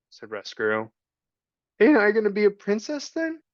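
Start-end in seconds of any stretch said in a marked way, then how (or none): 1.8-3.4 put-on high childish voice, much higher; a scrappy kid asking hopefully; rough little girl. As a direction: Meg asking the rat about her future. Meg's voice.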